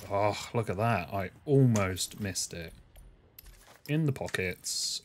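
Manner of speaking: ordinary talk, nothing marked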